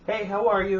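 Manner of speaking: high, loud, chirpy retold hello; pleasant greeting. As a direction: imitate her overly pleasant greeting. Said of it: "she's all pleasant and shit".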